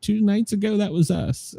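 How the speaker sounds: soft, offhand admission; nonchalant. Retold or imitated. Host quotes the president admitting the strike, nonchalantly. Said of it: "nonchalantly says".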